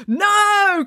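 high, loud drawn-out cry; loud, high-pitched chorus; chorused shout. A narrator's reading. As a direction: shout it like a crowd of children. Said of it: "chorused the children in the queue".

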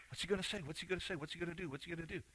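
very soft, rapid-fire crowd murmur; barely audible, passing the question along; hushed anticipation. Retold or imitated. Onlookers wondering as Jesus stops, hushed anticipation.

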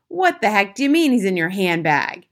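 loud, incredulous outburst; angry shout. A narrator's reading. Mister Jenkins shouts at grandmother, angry and baffled. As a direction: shout it in outraged disbelief. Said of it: "Mister Jenkins shouted".

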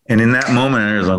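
ordinary delivery

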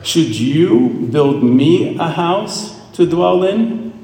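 drawn-out rising question; slow probing question; slow questioning voice of God. Retold or imitated. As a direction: ask slowly, as God challenging David. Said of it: "He says".

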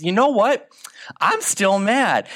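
high, mock-furious king; mock angry king. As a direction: act out the king still fuming. Angry king voiced in retelling.

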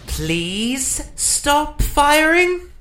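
slow, very high pleading yell; pleading shout. Acted. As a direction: beg them loudly to stop. Pleading with gunmen.

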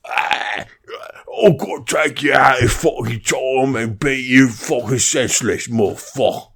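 slow, slurred British hooligan rant; British hooligan accent. Shown as a demonstration. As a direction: play a belligerent British hooligan, hammed up. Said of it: "British accent where I'm trying to sound like a hooligan".

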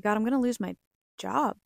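alarmed protest. Retold or imitated. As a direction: push back hard, alarmed at losing the job. Retold protest to God's command.

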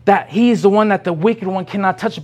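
usual speaking voice

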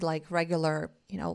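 ordinary delivery

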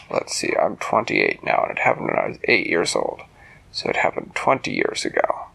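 put-on an old man counting back the years; low elderly storyteller voice; old man's voice.

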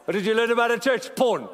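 playful pulpit shout; loud teasing call. Real, not acted. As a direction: fire the question and slam the answer. Teasing a quiet congregation.

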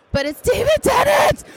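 high, loud fangirl shriek; excited shout. Real, not acted. Fan seeing David Tennant in line, a high excited shout. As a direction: shout his name in sheer disbelief. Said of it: "I was literally hyperventilating".

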